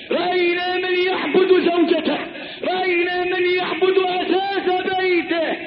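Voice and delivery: high, ringing repeated refrain; impassioned preaching. Acted. Preacher lists what people worship, high impassioned preaching. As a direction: hit each repeat in the same high, insistent cry. Preacher chants a list of idols.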